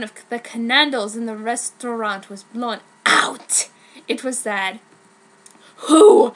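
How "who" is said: loud, held-up cry; hammed-up anguished demand; dramatic shout. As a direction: call out the question. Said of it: "said John".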